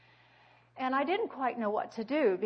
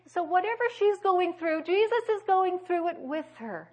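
higher and louder, ringing it out; preaching climax; 8 semitones higher, 8 dB louder than usual. Real, not acted. Preacher tells the congregation loudly that Jesus shares her suffering. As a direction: lift it to the rafters.

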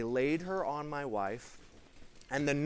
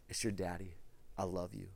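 soft tender repeat; 9 semitones lower, 12 dB quieter, faster than usual. Retold or imitated. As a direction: lower and much softer, hushed comfort. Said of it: "I just begin to again".

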